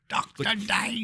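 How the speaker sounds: high, slow, stretched mock-Aussie "Doctor Dave". Shown as a demonstration. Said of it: "did you grow up in a cave".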